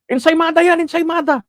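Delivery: high-pitched taunting yell; a heckler yelling at the vlogger, in a high mock voice; mock heckler shout. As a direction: imitate a heckler shrieking an insult.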